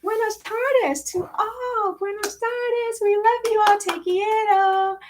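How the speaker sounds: beam it out to the viewers; gushing high greeting. Real, not acted.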